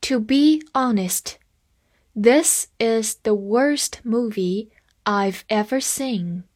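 slow, careful read-along for learners; deliberate classroom pace. Shown as a demonstration. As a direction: read it slowly so students can follow. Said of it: "跟着我慢慢来读".

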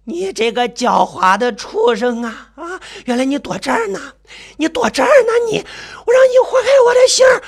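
rising to high, screeching rage; frenzied fury. Acted. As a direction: build from seething cry to screamed death threats. Crazed mother spots and attacks narrator.

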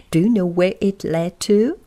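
plain reading voice

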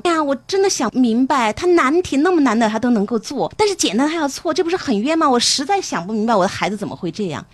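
模仿家长高声抱怨, 又急又气; 学家长拔高嗓门叹气埋怨孩子; 恨铁不成钢的家长. Retold or imitated. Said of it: "家长就会恨铁不成钢". (模仿家长对孩子恨铁不成钢, 高声急躁地抱怨)

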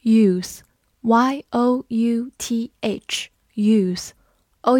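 slow, letter by letter; teacher spelling a word slowly. Shown as a demonstration. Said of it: "spells out the word".

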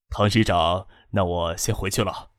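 low, soft, deferential leave-taking; low-voiced official. A narrator's reading. 张之行收拾文件向领导告辞. (低声轻缓地告辞)

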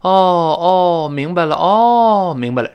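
drawn-out "oh, I get it"; dawning realization. Shown as a demonstration. Teacher mimics sudden understanding to show a sound, drawn-out realization. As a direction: stretch each oh loudly as if it just clicked. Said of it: "就是有点像我们".

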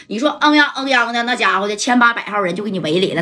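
plain reading voice

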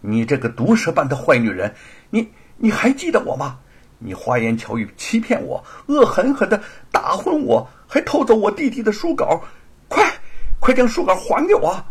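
loud, outraged accusation rising in pitch; furious rebuke. A narrator's reading. Wronged sister confronting the thief, furious rebuke. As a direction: hurl it as an enraged demand. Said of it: "气愤之极地怒斥".

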